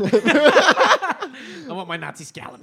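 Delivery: loud, high, drawn-out mock demand; slow, exaggerated comic yell; hammed-up movie shout. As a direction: bellow it like a parody war hero. Mocking misheard film line, hammed up.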